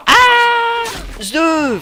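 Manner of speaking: high, loud shout with a made-up cry; battle yell. Real, not acted. Gamer firing a rocket, exultant battle yell. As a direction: shout it as you fire.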